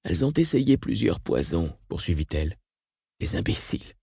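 soft, spent voice, fading to breath; weakened by coughing. A narrator's reading. Dortoujla resumes after a coughing fit, weakened. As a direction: let it fade to a near whisper after a coughing fit. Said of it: "s'interrompit pour tousser en une longue quinte spasmodique".

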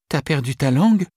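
put-on high, loud girl's taunt; teasing girl. A narrator's reading. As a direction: tease him as a girl. Sophie teasing Alberto.

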